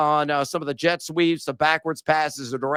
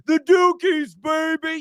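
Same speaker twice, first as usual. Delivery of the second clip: mocking high shout; 10 semitones higher than usual. Real, not acted. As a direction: yell it out at rival fans. Mocking rival fans, shouting.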